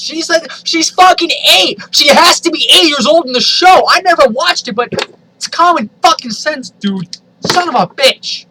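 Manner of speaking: high, loud furious rant; appalled reader shouting at the fanfic; outraged yelling. Real, not acted. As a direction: scream in disgust at the story. Outraged by the story's content.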